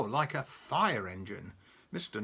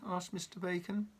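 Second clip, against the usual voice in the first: usual speaking voice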